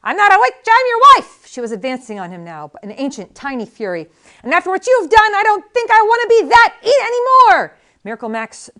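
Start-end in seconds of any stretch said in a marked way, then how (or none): 0.0-1.4 very high, loud indignant yell; furious old wife. A narrator's reading. Valerie yelling back at Max, very high and loud. 4.4-7.8 tiny ancient fury. A narrator's reading. Valerie raging at Max, high and loud.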